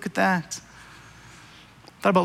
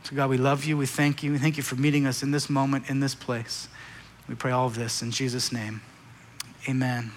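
low, soft praying voice; prayer; 6 semitones lower, 7 dB quieter than usual. Real, not acted. Preacher praying to God with the congregation, low and soft prayer. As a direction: pray it quietly, well below preaching pitch.

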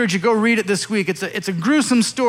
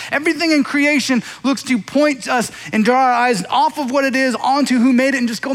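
ordinary talk

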